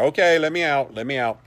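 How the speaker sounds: imitated calling out. Imitating the guide locked in the cellar, mock calling out. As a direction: call out as if banging to be freed. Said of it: "like, banging on the door".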